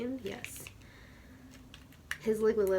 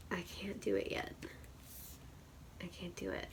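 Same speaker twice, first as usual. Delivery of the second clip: whisper; 2 semitones lower, 11 dB quieter, faster than usual. Real, not acted. Overwhelmed, voice drops.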